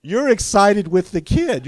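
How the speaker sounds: recalling the granddaughter's joy, loud and delighted; excited. Real, not acted. Preacher excited about granddaughter.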